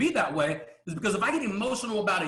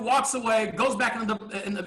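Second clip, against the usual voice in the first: ordinary delivery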